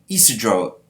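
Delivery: ordinary delivery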